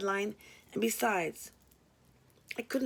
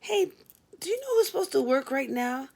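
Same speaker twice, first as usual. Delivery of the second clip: put-on much higher, bubbly young woman; chirpy Glenda; 5 semitones higher than usual. Late receptionist introducing herself, high and bubbly. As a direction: keep her high and sunny.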